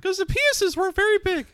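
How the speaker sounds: high squeaky cartoon mouse voice, laughing; Mickey Mouse Peterson. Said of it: "sound like Mickey Mouse".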